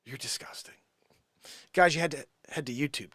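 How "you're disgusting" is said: whispered disgust; whispering. Real, not acted. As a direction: whisper it, grossed out. Mock disgust at Joanne.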